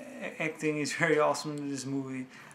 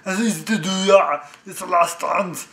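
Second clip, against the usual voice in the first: put-on boastful movie-star voice in a heavy Austrian accent; Arnold Schwarzenegger impression; 7 dB louder than usual. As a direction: keep rambling in a hammed-up Austrian accent. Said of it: "he's probably going to do that in that accent".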